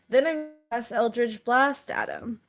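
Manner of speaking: ordinary delivery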